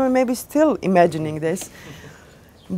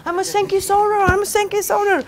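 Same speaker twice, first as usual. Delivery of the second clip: put-on much higher, childish insisting voice; like a small kid; 13 semitones higher than usual. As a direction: beg like an excited little kid. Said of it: "he was like a small kid".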